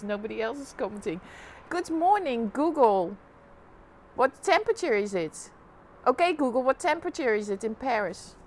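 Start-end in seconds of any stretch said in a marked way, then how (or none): 1.7-5.5 repeating to voice assistant. Real, not acted. 6.1-8.3 louder, spelled-out command; repeating to voice assistant. Real, not acted.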